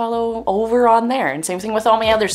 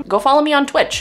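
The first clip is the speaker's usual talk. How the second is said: usual speaking voice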